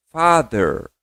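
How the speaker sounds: "Father" with the th said almost like a d; model word. Shown as a demonstration. Teacher models father for students to repeat. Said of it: "Repete comigo".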